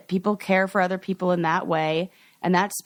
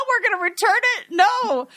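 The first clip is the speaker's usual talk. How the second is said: put-on little kid panic; 12 semitones higher than usual. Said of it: "his little kid brain being like".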